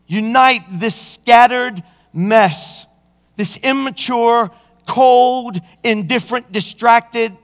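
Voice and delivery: slow, high pleading prayer; David's prayer, earnest and slow; fervent prayer. Pretend. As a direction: plead with God to unite the heart. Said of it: "David said".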